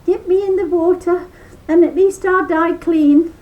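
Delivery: high loud rabbit begging; high sly rabbit plea; pleading trickster rabbit. A narrator's reading. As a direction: beg him not to, slyly.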